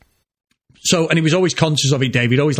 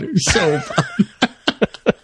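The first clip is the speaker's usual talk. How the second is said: laughing; 6 semitones higher, much slower than usual. Real, not acted. Laughing at own embarrassing story.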